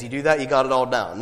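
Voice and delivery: usual speaking voice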